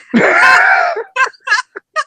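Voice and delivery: loud, high burst of laughter; cracking up hard at himself; howling laugh. Real, not acted. Big laugh at his own story.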